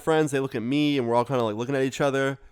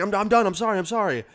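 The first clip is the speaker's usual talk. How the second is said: higher, frantic "I'm sorry"; mock panicked surrender; 8 semitones higher, faster than usual. Beaten stranger begs the kids to stop, higher and frantic. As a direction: give up fast, pleading.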